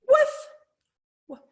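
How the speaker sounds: high, loud, short bark; sudden high-pitched woof; little dog woof. Shown as a demonstration. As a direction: yap like a small dog. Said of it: "Make a little woof sound".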